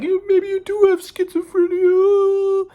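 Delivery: very high, slow mocking falsetto; whiny put-on girlfriend voice, stretched out; imitating girlfriend. Girlfriend doubting his sanity, in drawn-out mock falsetto. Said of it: "so she's like".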